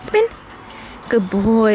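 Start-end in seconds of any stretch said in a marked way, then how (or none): 0.0-0.3 high coaxing to spin; high dog-talk voice. Real, not acted. Directing dog trick.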